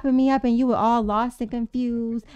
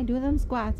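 usual speaking voice

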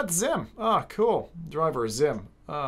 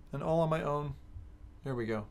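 normal talking voice

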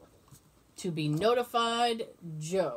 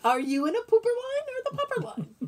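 high playful usher voice; mock usher; 13 semitones higher than usual. Usher sorting mourners into dog lines, high and playful. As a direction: play the funeral greeter sorting guests.

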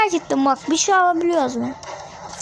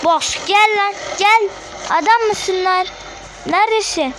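taunting call; 5 semitones higher than usual. Real, not acted. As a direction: goad the boss to come fight you. Taunting the boss to come.

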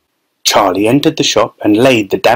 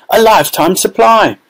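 envious; 9 semitones higher than usual. A narrator's reading. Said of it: "another boy said enviously".

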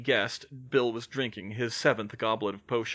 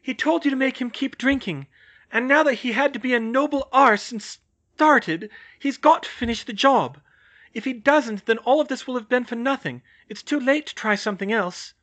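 high, raised, urgent argument; insistent; 11 semitones higher, 6 dB louder than usual. A narrator's reading. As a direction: press the point hard in a high voice. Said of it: "she insisted".

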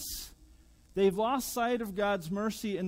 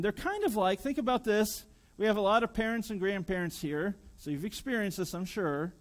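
usual speaking voice

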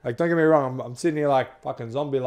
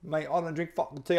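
ordinary delivery

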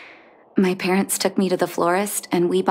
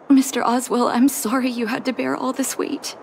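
normal talking voice

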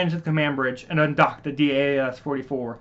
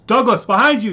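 high, loud warning yell; yelling to warn his brother; 8 semitones higher, 10 dB louder than usual. Acted. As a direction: shout the warning urgently. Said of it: "yelled Devin".

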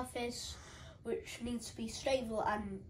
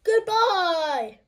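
shouted goodbye; 8 semitones higher, 13 dB louder than usual. Real, not acted. Calling out sign-off.

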